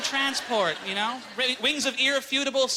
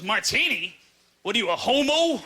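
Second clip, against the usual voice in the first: small-town guy. Retold or imitated. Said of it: "He goes".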